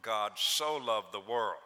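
God "so" loved the world, "so" drawn out and stressed. Shown as a demonstration. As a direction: press into the word "so", slow. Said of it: "to put emphasis upon the so".

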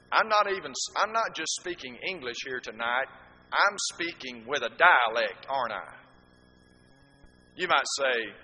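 usual speaking voice

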